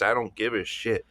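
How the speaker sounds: usual speaking voice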